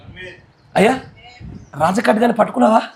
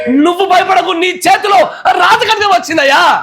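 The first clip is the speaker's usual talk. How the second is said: fervent shout; 9 semitones higher, 3 dB louder than usual. Real, not acted. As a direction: shout it high and loud from the pulpit. Preacher shouting God's promise. (బిగ్గరగా అరుస్తూ ప్రకటించడం)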